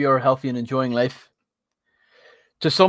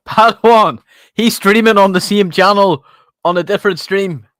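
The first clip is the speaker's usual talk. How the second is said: excited call; 6 semitones higher, 9 dB louder than usual. Real, not acted. Excitedly calling to a viewer.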